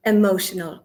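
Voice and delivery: ordinary delivery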